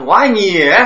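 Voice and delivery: emphatic 我爱你 with 你 stressed. Shown as a demonstration. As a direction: lean into 你 in 我爱你. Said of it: "强调".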